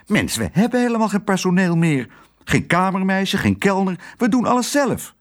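high, fast, indignant protest; exasperated hotel owner. A narrator's reading. Husband to wife, high fast indignation. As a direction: list it all in rising exasperation.

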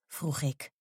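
ordinary delivery

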